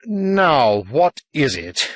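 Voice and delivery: firm, slow demand for sense; high start settling into measured patience; determinedly reasonable. A narrator's reading. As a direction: insist slowly, trying to be reasonable. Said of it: "determined to be reasonable".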